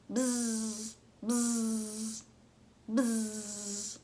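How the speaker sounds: buzzing bee noise bzz; imitated bee buzz. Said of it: "was all it said".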